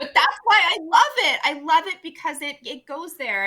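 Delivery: loud, high burst of enthusiasm; excited, emphatic outburst; impassioned gushing. Real, not acted. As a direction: gush over it, voice lifted. Impassioned rebuttal defending the song choice.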